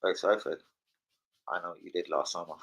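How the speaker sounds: low, hushed mock horror threat; spooky film line. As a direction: whisper it like a slasher film villain. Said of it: "Have you seen that film?".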